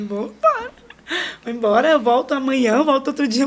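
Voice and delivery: crying out that she wants to go home; tearful pleading. Retold or imitated. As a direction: voice breaking high, then loud and insistent. Begging to leave the hospital. (chorando e implorando alto)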